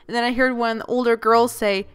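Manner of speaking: usual speaking voice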